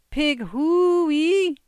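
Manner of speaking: high, loud, long-drawn pig call; hog call. A narrator's reading. Lord demonstrates the pig call as a sample, hog call. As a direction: sing out the call, soaring on the drawn-out cry. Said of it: "Let's hear a sample".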